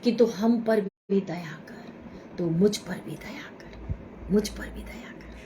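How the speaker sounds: soft whispered plea; hushed whisper fading out; whispered prayer. Shown as a demonstration. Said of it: "whispered prayer".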